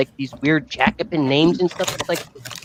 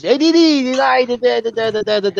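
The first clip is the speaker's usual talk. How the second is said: higher sing-song calling in mock Sicilian; mock Sicilian father; 9 semitones higher, 4 dB louder than usual. Friend imitating a Sicilian father calling, sing-song and higher. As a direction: call out like an old Sicilian dad, all melody. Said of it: "called me by the Sicilian version".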